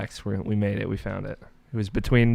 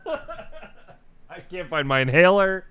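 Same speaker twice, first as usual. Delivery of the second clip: laughing; 9 semitones higher, much slower than usual. Real, not acted. Laughing while searching for inhaler.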